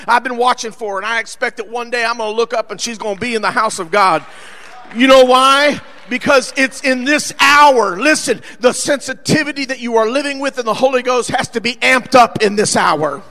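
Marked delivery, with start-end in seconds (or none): 5.0-13.2 loud, emphatic preacher challenge; forceful preaching. Real, not acted. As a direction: press the congregation, punching key words.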